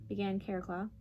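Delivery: usual speaking voice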